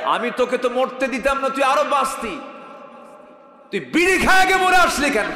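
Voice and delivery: furious rebuke. Acted. God voiced rebuking smoker.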